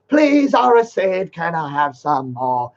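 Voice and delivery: slow, whiny pleading caricature; mock begging voice. Mocking the rival as begging beggar.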